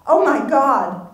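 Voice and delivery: high, loud, slow horrified cry; sudden fright at the window; shocked gasp. Acted. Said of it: "Marcy gasped".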